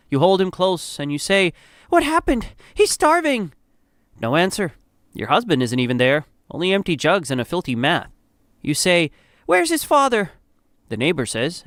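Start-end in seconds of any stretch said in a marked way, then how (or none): 1.9-3.5 much higher, distressed mother's voice; alarmed. A narrator's reading. Mother finds her son starving. 9.5-10.3 high-pitched anxious question; distressed demand. A narrator's reading. Mother demands the absent father.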